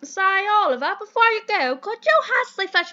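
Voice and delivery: high, hammed-up British gent; bad British accent. Mentor sends Oliver on an errand, hammed-up British accent. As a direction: ask a favour like a stagey British gentleman. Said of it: "do the worst British accent".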